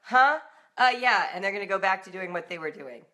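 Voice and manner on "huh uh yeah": a startled, louder "huh? uh, yeah"; sudden loud, higher startled reply; distracted kid. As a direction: play a kid pulled out of a game, half-listening. Kids' distracted reply acted out.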